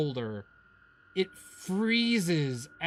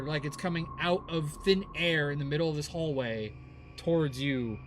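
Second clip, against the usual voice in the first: ordinary delivery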